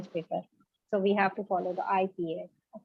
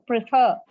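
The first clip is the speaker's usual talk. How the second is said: "prefer" said as preFER, second syllable stressed, falling; stress on FER; 6 dB louder than usual. Shown as a demonstration. Teacher showing the class stress on preFER. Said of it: "the second syllable is stressed".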